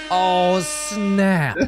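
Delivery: high, loud, stretched-out awe; hammed-up amazement. Host reacting to the first rock, hammed-up amazement. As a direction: overreact like it's a huge reveal.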